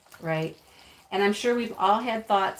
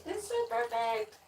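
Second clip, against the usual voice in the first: gushing; 13 semitones higher, 4 dB quieter than usual. Real, not acted. Gushing over a gift.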